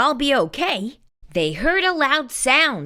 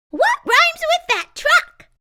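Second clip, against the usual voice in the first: much higher, louder, cheery tin friend; bright Tess; 5 dB louder than usual. A narrator's reading. Tess coaxing.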